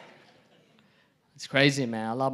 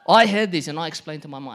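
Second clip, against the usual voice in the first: ordinary delivery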